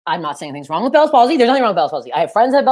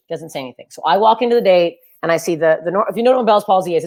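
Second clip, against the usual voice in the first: normal talking voice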